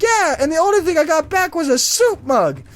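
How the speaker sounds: high, aggrieved old lady impression; put-on indignant old lady; elderly woman. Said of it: "it's this old elderly woman".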